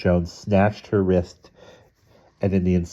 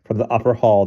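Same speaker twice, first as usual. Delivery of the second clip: plain reading voice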